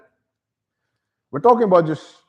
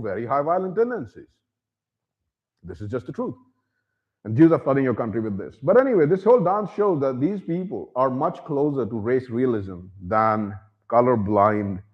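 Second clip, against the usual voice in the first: usual speaking voice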